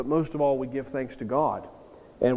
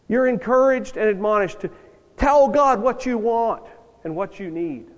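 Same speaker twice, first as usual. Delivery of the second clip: louder and higher, punching "tell"; emphatic preaching; 7 semitones higher, 5 dB louder than usual. Real, not acted. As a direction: drive the point home to the pews. Preacher insists on praying needs.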